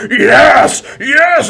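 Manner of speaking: high, loud monster cry of triumph; big gloating monster shout; exulting troll. Acted. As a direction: roar it with gleeful troll relish. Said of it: "the troll's like".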